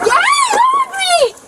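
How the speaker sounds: high, slow, furious scream; shouting. Real, not acted. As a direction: scream at them to let go. Woman fights officers in car.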